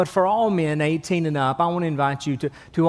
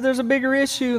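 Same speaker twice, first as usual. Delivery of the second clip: worried father; 8 semitones higher than usual. Acted. Panicked fourth father.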